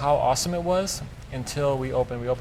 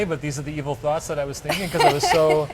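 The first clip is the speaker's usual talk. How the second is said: half-laughing, a bit louder; laughing confession; 3 semitones higher, 5 dB louder than usual. Real, not acted. Chef laughing to the interviewer about his evil thoughts. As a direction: giggle through the guilty admission.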